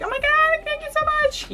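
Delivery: very high, breathy thank-you; teary gratitude. Retold or imitated. Fan thanking [name] after the picture, overwhelmed. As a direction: gush your thanks near tears. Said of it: "but I was like".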